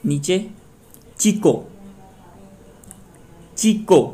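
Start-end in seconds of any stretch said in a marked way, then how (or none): 1.2-4.0 Chico with a high ची, dropping on को; stress on first syllable. Shown as a demonstration. Teacher demonstrates the stress rule, stressing ची in Chico. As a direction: lift the ची of Chico, let को fall. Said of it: "यहाँ पे ची पे stress होगा".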